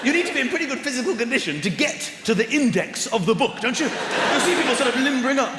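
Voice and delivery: ordinary delivery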